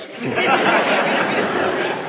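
high breathy laugh; a short amused laugh that is almost silent; real high airy laugh. Real, not acted. Laughs at friend's words.

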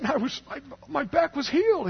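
high, mildly mock-tearful old man; Bob. Old man telling of his healed back, high, slightly tearful imitation. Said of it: "and he started crying".

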